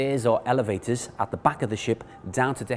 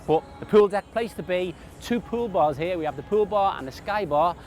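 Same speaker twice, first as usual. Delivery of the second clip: upbeat cruise director; 5 semitones higher than usual. Real, not acted. Cruise ship tour host.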